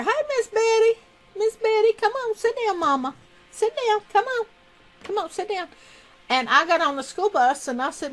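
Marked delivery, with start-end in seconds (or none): 0.0-5.7 much higher coaxing voice for a pet; pet talk. Real, not acted. Owner coaxing her hen Miss Betty to sit, high pet-talk voice. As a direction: coo at the hen to sit down, pitched way up.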